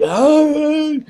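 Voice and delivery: high mock yell of panic; retold scream. Fleeing chasing instructors, high mock scream. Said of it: "I was like".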